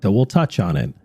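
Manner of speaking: normal talking voice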